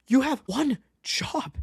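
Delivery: slow, high, every word hit in "You have one job"; exasperated. Real, not acted. Scolding the jersey company, slow exasperated stress. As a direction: spell it out slowly, fed up.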